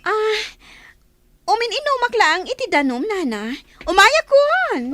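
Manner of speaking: put-on loud innocent call to the old woman. Caught, calling out an excuse.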